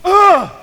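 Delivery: loud, high theatrical groan; mock an over-the-top sigh of misery; hammed-up exasperated ugh; dramatic ugh. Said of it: "U G H with an exclamation mark".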